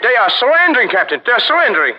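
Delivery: lieutenant eagerly telling the captain the fort gives up; excited report. Acted. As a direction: burst out with the good news.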